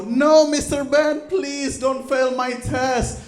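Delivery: high, slow, pleading student voice; begging student. Acted. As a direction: whine it out like a begging pupil. Student begging teacher, acted.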